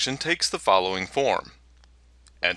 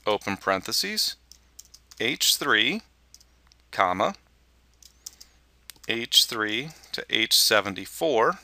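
dictating. Shown as a demonstration. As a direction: read each part of the formula out clearly. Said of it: "enter the following formula".

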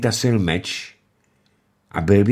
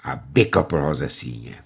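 ordinary delivery, nothing marked

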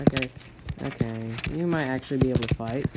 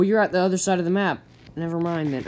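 ordinary delivery

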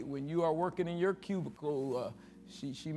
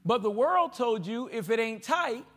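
higher, louder preaching to the crowd; fiery preaching; 5 semitones higher, 4 dB louder than usual. Acted. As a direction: preach it hard, pushing each line up. Preaching at full force, raised voice.

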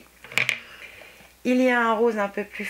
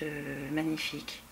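whispered awe; 5 semitones lower, 10 dB quieter than usual. Real, not acted. Admiring polish, voice dropping away.

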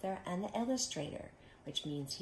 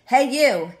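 high, loud challenging call; pushy storybook bully hailing someone; grouchy ladybug; 7 semitones higher, 19 dB louder than usual. Acted. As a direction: call out to pick a fight. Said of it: "said the grouchy ladybug".

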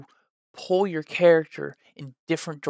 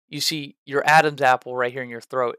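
ordinary talk

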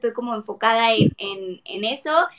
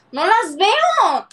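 higher, louder and slow; exasperated exclaim; 7 semitones higher, 8 dB louder, much slower than usual. Real, not acted. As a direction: stretch out the complaint.

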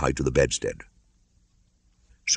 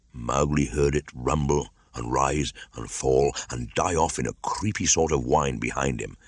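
usual speaking voice

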